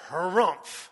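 a loud, hammed-up disapproving harrumph; mock indignant harrumph. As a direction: huff like an offended parent. Mocking a disapproving parent.

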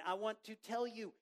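ordinary delivery

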